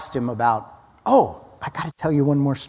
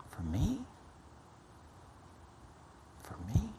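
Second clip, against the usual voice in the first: slow, softer and softer, fading to near silence; serious and hushed; 3 semitones lower, 7 dB quieter than usual. Retold or imitated. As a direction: let each word fall away, awed. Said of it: "very serious".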